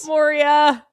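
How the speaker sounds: Moria said as mor-EE-uh; Moria, corrected. Shown as a demonstration. To the co-host, correcting the name: Moria as mor-ee-uh. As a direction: stretch Moria into three syllables, mor-ee-uh. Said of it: "Moira, I've gotten, not Moria".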